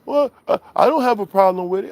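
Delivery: high mocking caller voice. Streamer retelling the troll's call, mocking voice. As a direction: pitch up to mimic the prank caller.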